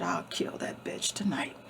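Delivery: menacing whisper from the lion; whispered threat. Retold or imitated. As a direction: hiss the threat under your breath. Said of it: "He said".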